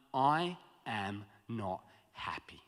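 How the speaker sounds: slow, whispered and sulky. Retold or imitated. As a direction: mutter it under your breath like a sulking child. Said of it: "And he goes".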